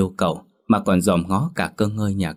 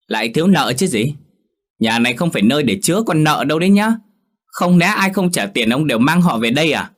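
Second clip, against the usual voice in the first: much higher and louder, fast; wife berating her husband over his debtors; angry tirade; 8 semitones higher, 5 dB louder than usual. Acted. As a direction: let the nagging boil over.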